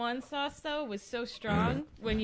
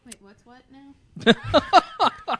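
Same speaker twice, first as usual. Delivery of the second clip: baffled; 2 semitones higher, 10 dB louder, much slower than usual. Real, not acted. Confused by a big phrase.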